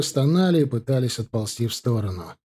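ordinary delivery

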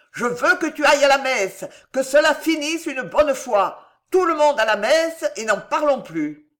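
high, loud insistent order; angry command. A narrator's reading. Enraged king issues ultimatum.

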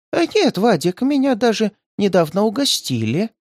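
high, gentle granny voice with a smile; smiling old woman. A narrator's reading. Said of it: "с улыбкой сказала старушка".

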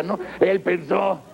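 ordinary talk

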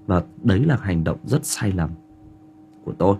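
usual speaking voice

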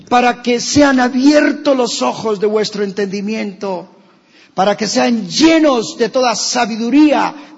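high, loud, slow impassioned preaching; fervent pulpit cry over music; preaching at full force. Acted. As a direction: preach it out, near shouting to the congregation. Preacher rises into fiery prayer.

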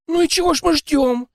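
high, loud excited woman; overjoyed. A narrator's reading. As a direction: jump up delighted. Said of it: "обрадовалась она".

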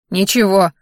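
short, mildly gruff bark. A narrator's reading. Grandfather cuts off his daughter-in-law's questioning, mild bark. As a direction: snap it out, lightly acted. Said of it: "рявкнул дед".

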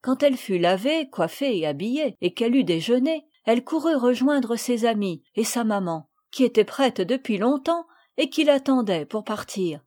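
ordinary delivery

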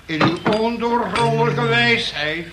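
preaching at full force; fiery prayer. Acted. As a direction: call down wrath like a preacher. Prays aloud at the group.

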